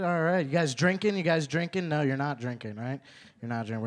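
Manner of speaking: ordinary talk, nothing marked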